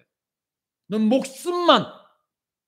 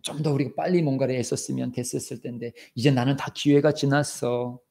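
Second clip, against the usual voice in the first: usual speaking voice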